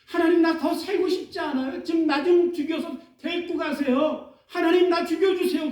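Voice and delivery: a mildly acted despairing prayer; Elijah's despairing plea. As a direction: give up, beg God to take you. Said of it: "엘리야가 로뎀나무 그늘 아래서 하나님 앞에 얘기합니다".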